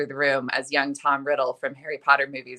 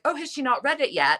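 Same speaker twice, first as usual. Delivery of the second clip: lightly imitated concern, higher voice; a mock worried question; 10 semitones higher than usual. Actor worried about the author's mom, mild mock concern. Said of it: "very concerned".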